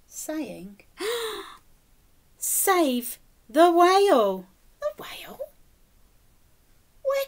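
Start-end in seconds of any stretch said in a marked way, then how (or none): 2.4-4.5 drawn out slow and loud; slow, dramatic reveal. A narrator's reading. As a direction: spell each word out like a grand message. Reading the trail message.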